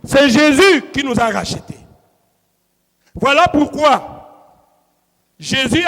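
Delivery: loud, emphatic question-and-answer preaching; forceful preaching. Real, not acted. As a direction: hammer each answer home after a pause.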